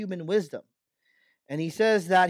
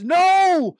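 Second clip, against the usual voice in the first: high, loud, commanding voice of God; booming divine declaration; voicing God; 9 semitones higher, 6 dB louder than usual. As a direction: proclaim it as God speaking with full authority. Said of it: "And yet God says".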